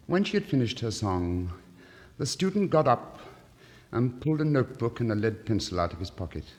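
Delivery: usual speaking voice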